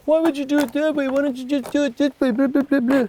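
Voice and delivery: mock the know-it-all; mocking imitation.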